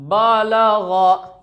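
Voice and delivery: बलग dragged out as baa-laa-ghaa; very slow, drawn-out बलग; wrongly stretched vowels. Shown as a demonstration. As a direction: hold every vowel of बलग very long. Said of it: "इसका गलत तरीका है".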